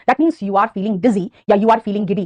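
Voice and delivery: patient teacher recap; slow summary. Shown as a demonstration. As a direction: spell out the meaning slowly. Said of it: "बेटा, ये सारे words".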